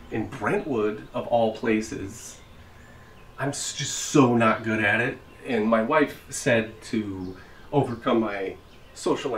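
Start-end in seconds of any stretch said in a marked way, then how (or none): none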